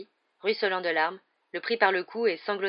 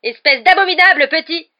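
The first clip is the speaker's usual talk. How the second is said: loud, higher furious insult; angry shout; 10 semitones higher, 16 dB louder than usual. Acted. Commentator insulting the cheat, loud and angry. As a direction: yell it down the megaphone. Lee keeps yelling insults.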